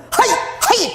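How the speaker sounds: very high, eager repeated 하이; Japanese hai. Retold or imitated. Japanese believers responding, very high and eager. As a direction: fire off two bright 하이. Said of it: "딱 이런다".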